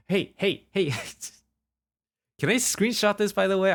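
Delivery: giggling with delight at chat; laughing excited. Real, not acted.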